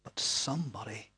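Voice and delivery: soft, low whisper; hushed whisper. Acted. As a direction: lean in and breathe it quietly. Jesus whispering after shouting.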